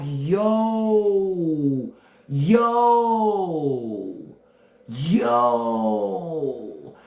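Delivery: alarmed yo; reacting in the movie. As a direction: call out to the screen. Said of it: "I was like".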